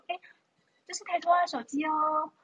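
a hint of a chirpy mom; lightly voiced mom answering a phone. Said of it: "她就接着电话说".

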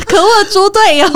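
high, loud mock fury; mock outrage. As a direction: scold the useless teammate husband. Mother venting at husband.

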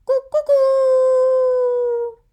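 high, loud crow, very slow last note; rooster crowing. Shown as a demonstration. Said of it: "那公鸡的声音呢".

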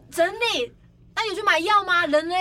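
loud, high, incredulous; re-enacted urgent alarm; alarmed questioning. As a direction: press for answers in disbelief. Retold alarmed questioning of assistant.

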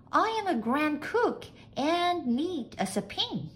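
high-pitched maid's voice; high, sing-out maid voice; eager maid. A narrator's reading. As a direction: sell yourself in a high maid's voice. Maid praises her own skills.

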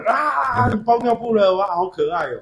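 high teasing cheer; a hammed-up cheer for the loser; mock hype. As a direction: friend teasing the kid who lost, high mock cheer. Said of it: "要有人在那个游戏的过程里面".

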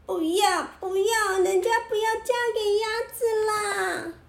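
high, tearful tantrum yell; whiny crying shout. A narrator's reading. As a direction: wail it like a spoiled princess. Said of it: "小公主忍不住叫了起来".

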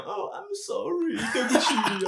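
put-on high, slow slurred drunk apology; drunk mother. A mild drunk mother apologizing to her son, slow and high. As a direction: play the tipsy mom apologizing. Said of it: "烂醉在素食店啊".

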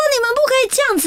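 high-pitched mock indignation; indignant protest. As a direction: protest high, like a wronged kid. Retold protest against the forfeit.

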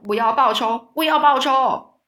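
loud vengeful yell, rising into a roar; furious yell. A narrator's reading. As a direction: spit it through clenched teeth. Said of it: "咬牙切齿的叫着".

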